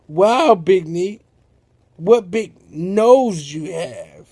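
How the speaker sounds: drawn-out mock amazement; slow wondering. Sammy marvels at the giant Big Neat, slow. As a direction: stretch each word in awe.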